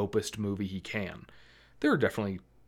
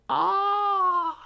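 shrill fangirl shriek, very high; girl's high scream; 5 dB louder than usual. Retold or imitated. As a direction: scream like a fan losing it. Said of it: "close-up shots of girls just like".

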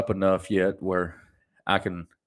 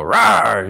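loud playful rawr; playful monster growl; 7 dB louder than usual. Retold or imitated. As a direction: jump out and roar to startle. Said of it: "I'll go".

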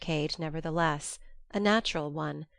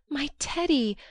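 rising joyful call of Teddy, a touch louder; joyful cry; 8 semitones higher, 3 dB louder than usual. A narrator's reading. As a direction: call out Teddy in glad surprise. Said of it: "crying joyfully".

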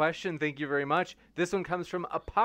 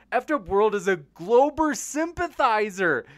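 mock outrage; 7 semitones higher, 4 dB louder than usual. Host reads mock-outraged chat.

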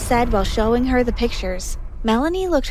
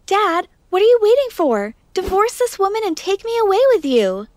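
higher, louder insistent demand; urgent plea; 7 semitones higher, 3 dB louder than usual. Acted. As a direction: push dad to leave now. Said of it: "narrator demands divorce".

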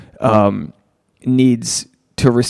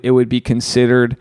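ordinary talk, nothing marked

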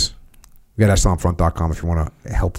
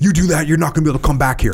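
fast, higher threatening warning; 7 semitones higher, faster than usual. Shown as a demonstration. How Tony did not say it, a fast higher threat. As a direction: snap the threat at him. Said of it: "He wasn't like".

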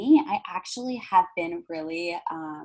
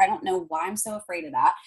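ordinary talk, nothing marked

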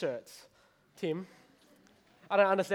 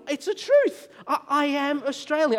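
high, loud mock-indignant insistence; hammed-up pleading to be believed; insistent protest; 8 semitones higher, 5 dB louder than usual. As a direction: protest it, pitched way up, to someone who doubts you. Retold protest to doubting shop owner.

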